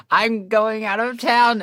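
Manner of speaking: high, drawn-out protest; stretched-out, high-pitched mock complaint; acted dismay. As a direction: wail it slowly in mock dismay.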